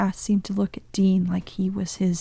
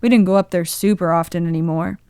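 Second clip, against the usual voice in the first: plain reading voice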